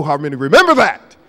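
normal talking voice

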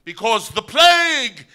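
slow, high, loud proclamation; the scripted line, shouted to the general; dramatic proclamation. Retold or imitated. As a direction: announce the doom theatrically. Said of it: "the line was".

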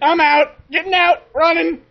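high, loud bursts of panic; urgent high yell; panicked shouting. Real, not acted. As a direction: shout it while bolting away. Fleeing the monster in game.

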